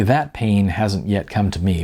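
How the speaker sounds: ordinary delivery, nothing marked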